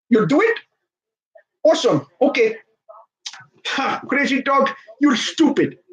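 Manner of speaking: high put-on executive voice on a call; Masai Ujiri. Team boss closing a deal with the Grizzlies, mock gloating. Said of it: "rings up the, you know, the Grizzlies".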